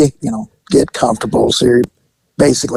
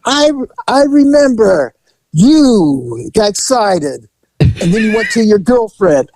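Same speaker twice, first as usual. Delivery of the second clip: high, slow broken-English voice; fond masseuse; 6 semitones higher, slower than usual. Retold or imitated. Masseuse reminding client, high and slow. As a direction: play her remembering him fondly.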